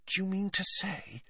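soft, high hushed voice; hushed, tentative question. A narrator's reading. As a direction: say it under your breath in disbelief. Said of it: "said Hermione in a hushed voice".

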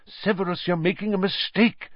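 urgent. A narrator's reading. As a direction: press him urgently to listen. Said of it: "said Lupin urgently".

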